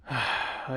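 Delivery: short, heavy exhale; resigned sigh. Acted. Officer resigned before answering.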